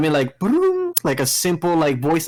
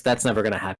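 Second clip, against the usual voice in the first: usual speaking voice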